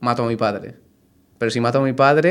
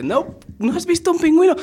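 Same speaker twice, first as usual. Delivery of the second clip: high incredulous squeal; mock horror at the confession; hammed-up outrage, much higher; shocked disbelief. Cellmate shocked before killing him.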